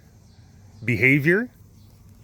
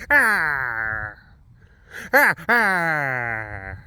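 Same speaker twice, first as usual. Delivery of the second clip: imitated tiger call; much slower than usual. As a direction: growl "arr" again like a tiger. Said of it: "that's kind of what it sounds like".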